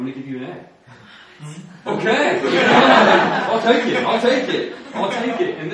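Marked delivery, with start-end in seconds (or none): 1.9-2.4 high laughing okay, rising; amused okay through a laugh. Real, not acted. Funny grade-bumping story punchline. 2.4-3.4 high loud burst of laughter; real loud laugh. Real, not acted. Laughing after the story. 3.5-5.6 high, loud, laughing repeats; amused, accepting it through laughter. Real, not acted.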